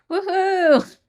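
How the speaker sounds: high, loud sarcastic woohoo; mock cheer. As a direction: cheer with ironic glee.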